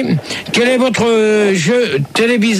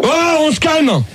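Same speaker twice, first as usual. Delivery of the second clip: higher-pitched call to order; irritated reprimand; 7 semitones higher than usual. Real, not acted. Host calling chatty callers to order, irritated. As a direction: rein them in, annoyed.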